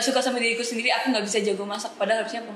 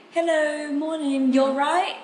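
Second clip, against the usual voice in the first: higher, louder British-accented hello; British greeting; 6 semitones higher, 4 dB louder, much slower than usual. Shown as a demonstration. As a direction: greet cheerily in a British accent, ending on a rise. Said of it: "sering kayak nanya apa kabar". (sapaan logat British bernada tinggi)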